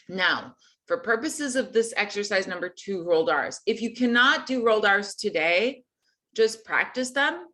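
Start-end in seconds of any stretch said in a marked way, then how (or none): none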